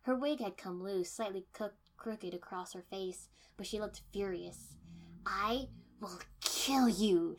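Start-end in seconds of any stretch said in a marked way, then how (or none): 5.2-7.3 slow, loud rasp. A narrator's reading. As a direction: rasp it out in fury. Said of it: "she rasped".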